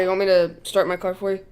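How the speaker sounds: ordinary talk, nothing marked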